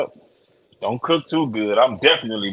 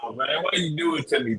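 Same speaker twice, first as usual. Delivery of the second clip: mock plea, higher and softer; hammed-up little lament; put-on acting; 4 semitones higher, 6 dB quieter than usual. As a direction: play up a fake complaint. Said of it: "had to put on the acting role".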